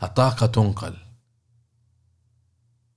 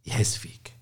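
whisper; 8 dB quieter than usual. Acted. Confiding point to listener.

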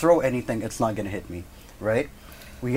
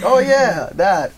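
high, loud fake realization; overdone innocent recognition; playing dumb; 7 semitones higher, 8 dB louder, much slower than usual. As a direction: pretend it only just dawned on you. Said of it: "And, like, playing dumb".

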